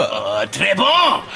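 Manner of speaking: slow, drawn-out hammed French; mock French praise, slowly. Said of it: "speak to me in French".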